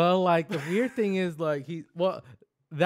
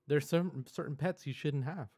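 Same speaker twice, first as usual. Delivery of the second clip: ordinary delivery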